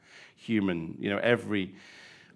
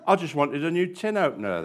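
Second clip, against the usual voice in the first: usual speaking voice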